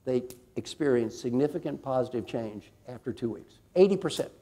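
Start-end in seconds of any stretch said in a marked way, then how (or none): 3.8-4.3 eighty punched out loud and high; proud booming statistic; emphatic announcement. Real, not acted. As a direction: hit the number hard for the crowd. Announcing striking result to audience.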